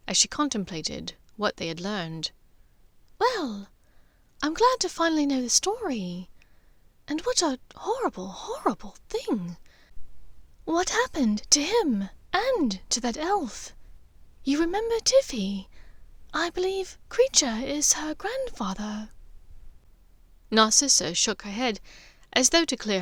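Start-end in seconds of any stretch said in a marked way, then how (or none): 3.2-6.3 a gentle, higher mother's voice, sad and wondering. Acted. Narcissa voiced by narrator. 7.1-9.6 hushed dismay. Acted. Dismay at the horrible story. 10.7-19.1 a gentle, higher mother's voice, sad and wondering. Acted. Narcissa voiced by narrator.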